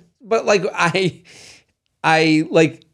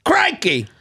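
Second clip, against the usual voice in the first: put-on Australian accent; 10 semitones higher than usual. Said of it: "Oh, that's Australian".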